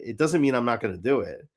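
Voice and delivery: usual speaking voice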